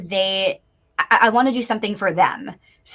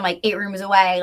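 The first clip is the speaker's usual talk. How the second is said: ordinary talk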